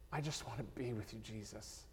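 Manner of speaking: barely voiced, a fast tender plea; soft pleading whisper. Freed man begging Jesus to stay with him, whispered. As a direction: whisper it like a longing prayer.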